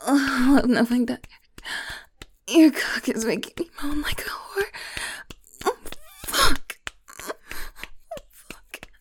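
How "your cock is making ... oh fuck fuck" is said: usual speaking voice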